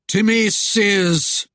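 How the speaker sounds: slow, loud, drawn-out summons from a spectre; eerie voice. A narrator's reading. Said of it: "The eerie voice sent cold shivers".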